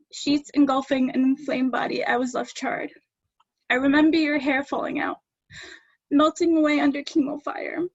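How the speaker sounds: poetry reading, close to tears; emotional poem reading. Acted. Daughter reading about her mother's chemo, close to tears. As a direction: hold back the tears and keep reading.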